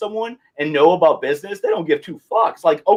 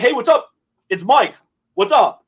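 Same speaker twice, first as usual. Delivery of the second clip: casual cold-call greeting; 6 semitones higher than usual. Retold or imitated. Replays own casual cold-call opener.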